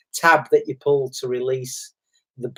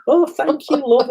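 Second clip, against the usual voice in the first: much higher, gushing thanks; grateful girl; 12 semitones higher than usual. Retold or imitated. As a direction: imitate a delighted young woman thanking him. Grateful girls, voiced.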